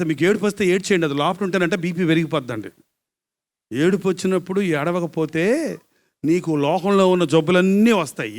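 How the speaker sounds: normal talking voice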